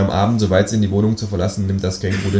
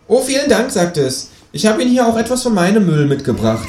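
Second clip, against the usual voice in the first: sweetly cheeky kangaroo; 13 semitones higher than usual. Acted. Kangaroo thanking the ticket boy, high faux-polite voice. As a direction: feign politeness with a laugh.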